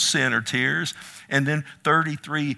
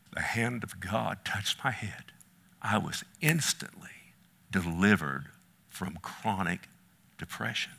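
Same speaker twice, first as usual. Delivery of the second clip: low, soft, partly whispered testimony; hushed awe; 6 semitones lower, 7 dB quieter than usual. Real, not acted. Preacher recounts God touching him, in hushed awe. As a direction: let the voice sink into a reverent hush.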